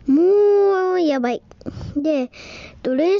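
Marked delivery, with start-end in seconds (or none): 0.1-1.4 exasperated exclaim. Real, not acted. Complaining about hard swim test.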